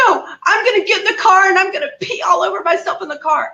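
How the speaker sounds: high, fast, defiant rush as Mom; imitated mother. Mother refusing to go back inside, imitated high and fast. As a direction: mimic a stubborn mother rattling off a threat. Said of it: "And she goes".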